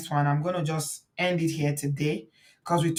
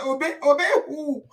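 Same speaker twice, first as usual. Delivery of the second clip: high, laughing imitation of a defiant friend; mock defiance; 8 semitones higher, 8 dB louder than usual. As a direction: quote her scoffing through laughter. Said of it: "Angela say".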